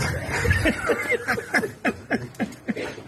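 high unvoiced scoffing laugh; breathy mocking laugh. Real, not acted. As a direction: laugh at him with contempt. Mocking laugh after taunt.

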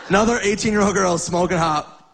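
ordinary delivery

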